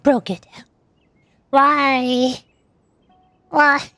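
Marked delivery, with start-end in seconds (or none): none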